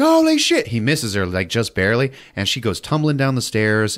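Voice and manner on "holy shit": high, loud startled cry; shocked yelp. Retold or imitated. Sarah nearly shot on the stairs, shocked yelp. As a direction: jump back in shock.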